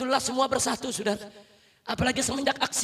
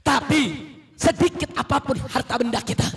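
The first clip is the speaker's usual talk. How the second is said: loud, high preaching building to a shout; fiery sermon; 4 semitones higher, 5 dB louder than usual. Real, not acted. Preacher rousing the congregation, loud and rising. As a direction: preach with full fire to the crowd.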